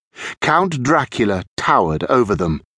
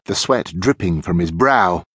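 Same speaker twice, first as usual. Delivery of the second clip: ordinary delivery, nothing marked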